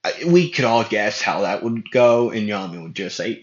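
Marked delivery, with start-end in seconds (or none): none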